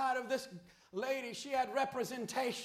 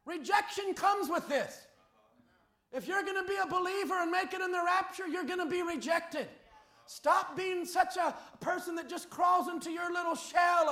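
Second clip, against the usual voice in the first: loud, rising preacher's cry to the flock; fiery preaching; 4 semitones higher, 5 dB louder than usual. Acted. Pastor pleading with the congregation, loud fiery preaching. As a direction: preach it out, pushing hard on every phrase.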